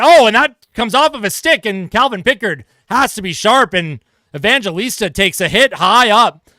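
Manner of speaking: rising commentator alarm; excited call. Acted. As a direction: punch the sudden chance. Deflection and hit call.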